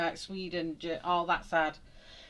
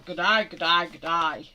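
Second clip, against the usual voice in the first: put-on Aussie accent practice; 12 dB louder, much slower than usual. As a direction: play it broad and Australian, louder each time. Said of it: "going round going".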